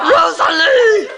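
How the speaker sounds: calls the name out loud, half laughing; slow laughing call across the room; laughing shout. Real, not acted. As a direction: yell her name through a laugh. Calling a friend, laughing.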